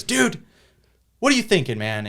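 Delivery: loud, high, harsh scolding; abrasive yelling. Retold or imitated. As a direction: bark it at the kid like an angry principal. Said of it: "a little abrasive".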